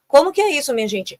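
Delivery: ordinary talk, nothing marked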